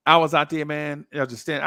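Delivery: ordinary talk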